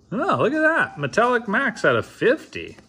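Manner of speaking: high-pitched delighted reaction; excited. Real, not acted. Card opener showing a rare gold pull, excited and high. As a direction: marvel at the find, pitch way up.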